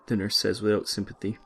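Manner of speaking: ordinary delivery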